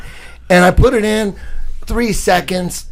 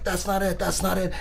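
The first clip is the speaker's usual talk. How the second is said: higher, softer quoted voice; imitating girlfriend's soft protest; 4 semitones higher, 6 dB quieter than usual. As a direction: say it small and high, as the girl.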